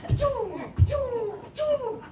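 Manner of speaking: rising pitched boing sounds; frog jump noise. Retold or imitated. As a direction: mimic a frog leaping across the tank. Imitating frog jumping.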